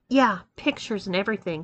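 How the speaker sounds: plain reading voice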